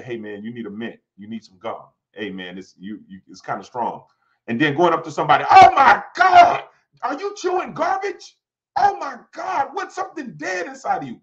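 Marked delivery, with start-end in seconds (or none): 0.0-4.0 low, soft private word; discreet tactful aside. Acted. Preacher quietly telling somebody they need a mint, discreet and low. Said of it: "it's a way of telling somebody". 5.5-11.2 high-pitched, hammed-up disgust; publicly embarrassing somebody. Preacher mimicking someone putting a person on blast, exaggerated high outrage. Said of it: "That's embarrassing somebody".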